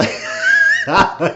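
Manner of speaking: loud burst of laughter; big, helpless laugh; real laugh. Real, not acted. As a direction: crack up at your own retelling. Cracks up at own retelling.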